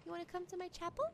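hushed, gentle invitation; soft coaxing. Real, not acted. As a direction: coax quietly. Coaxing someone to come along.

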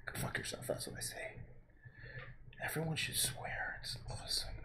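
hushed conspiratorial whisper; playful whispered swearing. Pretend. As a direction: whisper it like a naughty secret. Mock secret swearing advice.

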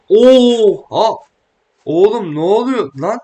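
loud, high shocked yelp; startled outcry. Real, not acted. Gamer shocked by sudden chaos on screen, loud. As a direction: shout in sudden alarm.

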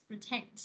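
"protect" as pro-TECT; "protect" with a light first syllable; stress on second syllable. Shown as a demonstration. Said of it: "Còn nhấn hai là protect".

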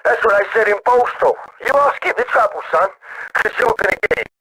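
put-on high-pitched drunken outburst; drunk, angry. Drunk caller stung at being called an idiot, high angry threat.

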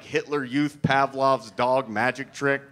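ordinary talk, nothing marked

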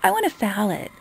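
lightly put-on customer voice; a touch of mimicry; mock customer. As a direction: imitate a customer stating an order. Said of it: "don't come up to me and go".

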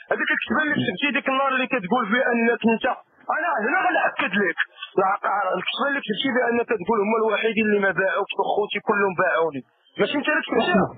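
fast, tinny phone line voice; over the phone. Real, not acted. Caller speaking over phone line.

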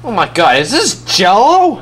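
usual speaking voice

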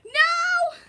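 high, loud cry of protest; dismayed shout. Real, not acted. Player about to lose, high dismayed shout.

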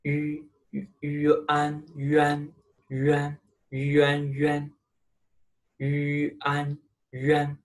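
yuan built from yu and an; pinyin yuan demo. Shown as a demonstration. Teacher shows students how yuan is said. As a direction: blend yu into an: yuan. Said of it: "It's pronounced yuan".

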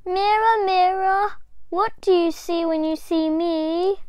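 put-on high, loud child's chant to the mirror; little girl calling. A narrator's reading. Little Diane calling to the magic mirror. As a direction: call out hopefully. Girl tries again bravely.

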